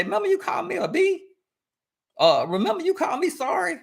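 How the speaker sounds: high-pitched accusing reminder, rising at the end; retold reproach. Partner bringing up an old insult a year later, mock reproach. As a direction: imitate someone digging up an old insult.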